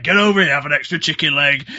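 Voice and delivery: loud, high, hearty mock call; acted calling over. Inmate offering the newcomer extra food, loud acted call.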